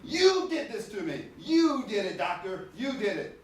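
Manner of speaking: high, whiny voice of a patient in pain; complaining patient. Retold or imitated. Patient blaming the dentist for pain, high whiny complaint. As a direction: whine it like a sore, accusing patient.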